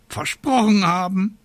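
high, incredulous raven echo; croaking raven. A narrator's reading. Raven mocking the witch's excuse, high croak. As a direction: throw it back as a scornful high croak. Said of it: "krächzte der Rabe Abraxas".

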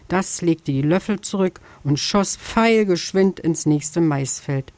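usual speaking voice

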